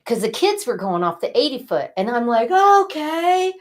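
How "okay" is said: high, drawn-out mock okay; an uneasy okay, louder and pitched way up; retold hesitant okay. Agreeing to the eighty foot jump, mock-uneasy high okay. Reluctant reply quoted in story.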